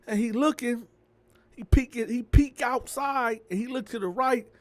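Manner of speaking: ordinary delivery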